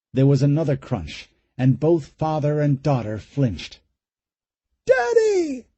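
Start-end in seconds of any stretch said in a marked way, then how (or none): 4.9-5.7 put-on high, frightened child's cry; terrified girl crying out. A narrator's reading. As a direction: scream for her father in fear. Terrified girl calling her father.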